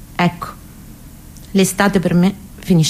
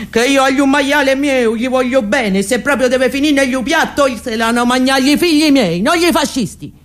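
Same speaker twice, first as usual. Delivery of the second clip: higher, proud peasant grandmother voice; defiant old grandmother; 8 semitones higher than usual. Acted. Nonna defying the fascists over her pig, higher proud voice. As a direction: play the stubborn nonna guarding her hams.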